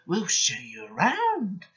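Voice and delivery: high, slow, wheedling voice; drawn-out tricky singsong; sly trucks. A narrator's reading. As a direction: offer help with mischief behind it. Troublesome trucks trick the twins.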